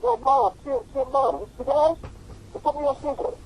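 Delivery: squeaky high imitation of the exec; comic high-voiced impression; high mock voice. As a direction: pitch it way up to mimic him. Retold phone call from exec.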